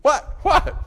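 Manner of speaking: high-pitched yelp of disbelief; mock child's incredulous squawk; shocked child. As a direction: squeak it out, startled and loud. Child shocked at announced spanking.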